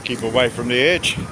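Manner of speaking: ordinary talk, nothing marked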